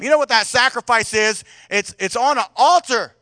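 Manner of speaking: normal talking voice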